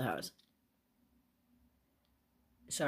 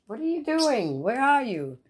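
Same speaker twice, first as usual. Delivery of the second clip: high, loud sing-out to a bird; calling to a pet; 8 semitones higher, 7 dB louder than usual. Real, not acted. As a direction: call out to a pet, pitch swooping.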